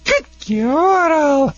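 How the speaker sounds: high, bright praise for the dog; praising. Retold or imitated. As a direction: reward her with a lifted "good girl". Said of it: "look in her eyes and say".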